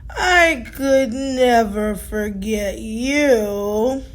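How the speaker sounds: low, slow and unhurried; Tortoise answering Fiona slowly; slow old tortoise. Acted. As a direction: take your time like an ancient tortoise.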